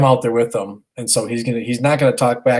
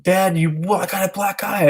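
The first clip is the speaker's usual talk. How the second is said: put-on higher-pitched kid protesting to dad; imitated upset son; 8 semitones higher than usual. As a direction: mimic a child's complaint, pitched up. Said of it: "and he's like".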